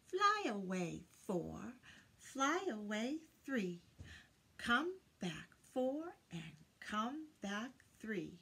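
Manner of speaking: ordinary delivery, nothing marked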